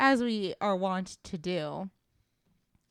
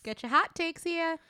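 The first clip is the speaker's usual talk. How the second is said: high market barker call; cockney street seller cry; 8 semitones higher than usual. Pretend. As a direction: hawk it like a street vendor. Hawking hot takes like vendor.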